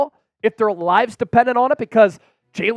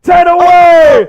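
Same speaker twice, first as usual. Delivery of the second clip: loud, high, slow shout; excited shout; 6 semitones higher, 9 dB louder, slower than usual. Real, not acted. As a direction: bellow it out, pumped. Countdown near sub goal.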